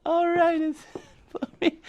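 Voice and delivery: high mocking echo of her retort; mocking Paige. [name] mimics Paige's rude reply, high and mocking. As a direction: parrot her words back in a squeaky voice. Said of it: "[name] mocks Paige's retort".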